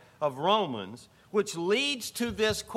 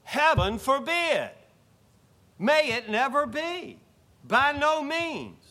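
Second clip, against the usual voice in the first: higher, louder and slow, every word weighted; emphatic Paul exclamation; 7 semitones higher, 5 dB louder, slower than usual. Acted. Preacher voicing Paul to the congregation, slow emphatic outcry. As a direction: thunder Paul's refusal like a preacher's outcry. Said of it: "And Paul says".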